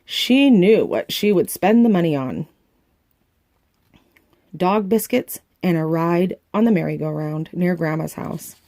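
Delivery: plain reading voice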